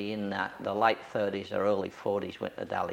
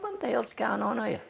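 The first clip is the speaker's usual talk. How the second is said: much higher, baffled; puzzled thought; 12 semitones higher than usual. Retold or imitated. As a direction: wonder aloud, pitched up.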